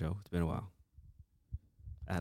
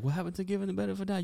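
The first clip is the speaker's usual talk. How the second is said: much higher, fast disbelief; incredulous high voice; 5 dB louder, faster than usual. Retold or imitated. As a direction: squeak it in disbelief. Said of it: "And I was like".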